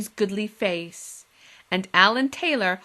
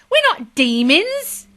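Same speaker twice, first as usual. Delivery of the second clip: slow, loud indignant correction; 9 semitones higher, 9 dB louder, slower than usual. Acted. Girl insisting to the menfolk, slow indignant. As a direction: set them straight, offended. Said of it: "said Polly indignantly".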